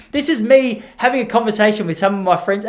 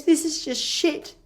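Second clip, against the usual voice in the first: put-on disappointed kid; 10 semitones higher, 5 dB quieter, slower than usual.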